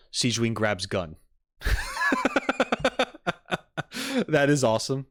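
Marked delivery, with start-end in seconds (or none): 1.6-4.2 long, high-pitched burst of laughter; real helpless laugh, high and drawn out; big laugh. Real, not acted. Reacting to Sigewinne grabbing a gun, high laughing fit.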